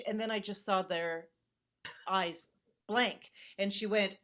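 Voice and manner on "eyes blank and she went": normal talking voice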